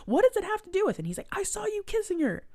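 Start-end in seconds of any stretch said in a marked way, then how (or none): 0.1-1.0 high confused mock. Viola confused question. 1.3-2.4 high mock accusation. Channing accusing.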